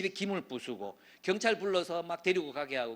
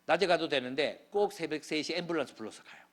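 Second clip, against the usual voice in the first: ordinary delivery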